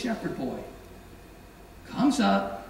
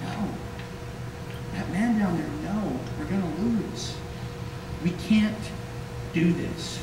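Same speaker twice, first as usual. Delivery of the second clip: quiet, mild fearful mutter; scared soldiers; 4 semitones lower, 5 dB quieter than usual. Retold or imitated. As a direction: say it under your breath, losing heart. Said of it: "Can't you hear it?".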